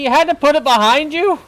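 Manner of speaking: high, loud incredulous complaint; exasperated. Real, not acted. Gamer scolding the game for bad placement, exasperated. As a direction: protest loudly at the game in disbelief.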